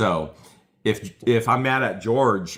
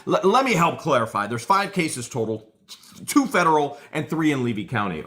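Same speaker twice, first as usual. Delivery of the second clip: mock eager lawyer; 8 semitones higher than usual. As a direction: stammer in, then rush to clarify. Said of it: "Saran Wrap jumps in and goes".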